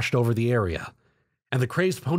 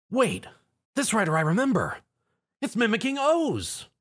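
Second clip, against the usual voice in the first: realization; 9 semitones higher than usual. Acted. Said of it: "Zio realized".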